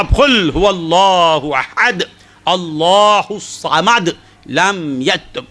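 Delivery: very slow, measured tajweed recitation; careful recitation. Retold or imitated. Family reciting Surah Ikhlas over soil, very slow. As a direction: draw out every letter with pious care. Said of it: "تجوید کے ساتھ، تلفظ کی برابر ادائیگی".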